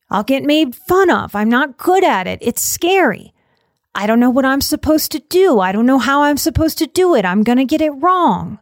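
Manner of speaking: put-on petulant little kid listing excuses; whiny inner child. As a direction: whine like a resistant child who won't try. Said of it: "the child looks at a dilemma and goes".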